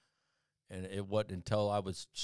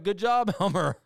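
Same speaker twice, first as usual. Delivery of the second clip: much higher and louder mock praise, laughing; sarcastic cheer; 10 semitones higher, 11 dB louder than usual. Friends mocking Elmer after the women left, loud sarcastic cheer. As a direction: cheer him on with heavy sarcasm.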